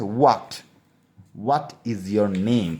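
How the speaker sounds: what is your name said very slowly, each word apart; very slow model. Shown as a demonstration. Teacher slowly modelling what is your name for students. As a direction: model the sentence slowly for beginners. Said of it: "এই মতো করে".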